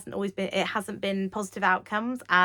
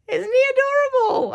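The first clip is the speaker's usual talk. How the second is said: gushing delight; 10 dB louder, much faster than usual. Real, not acted. Showing cute iron-on pirate.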